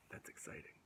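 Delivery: usual speaking voice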